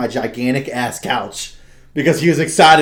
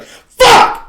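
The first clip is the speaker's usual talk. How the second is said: sudden furious shout; 13 dB louder than usual. Retold or imitated. Gamer sniped mid-match, screaming in rage. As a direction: explode into a high, loud curse. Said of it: "you're like".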